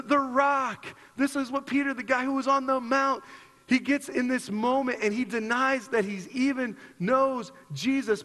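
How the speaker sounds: ordinary talk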